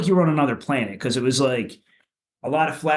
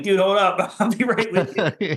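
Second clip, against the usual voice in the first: laughing, out of breath; 6 semitones higher, slower than usual. Retold or imitated. Lagging behind on steep hills.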